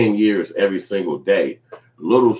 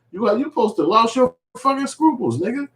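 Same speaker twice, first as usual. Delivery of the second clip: ordinary talk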